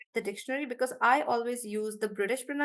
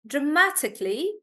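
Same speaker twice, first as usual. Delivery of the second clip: dramatically with MA stressed; 3 semitones higher than usual. Shown as a demonstration. Teacher repeating dramatically, ma stressed. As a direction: lean on the second syllable of dramatically, "ma". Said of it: "Stress is on ma".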